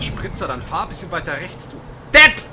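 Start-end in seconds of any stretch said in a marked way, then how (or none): 1.7-2.4 loud, high angry insult; angry yell. Real, not acted. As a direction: yell at the other driver. Yelling at other driver.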